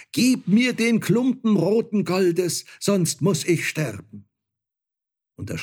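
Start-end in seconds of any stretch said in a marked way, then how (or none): 0.1-4.3 high, loud desperate plea; crying out. A narrator's reading. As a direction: call out begging as if life depends on it. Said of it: "schrie nach ihm und sprach". (verzweifelt flehender Ruf)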